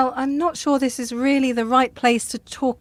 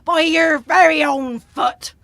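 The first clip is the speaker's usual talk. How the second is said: ominous sea-dog diagnosis; pirate voice. Acted. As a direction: pronounce doom like a pirate. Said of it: "while talking like a pirate".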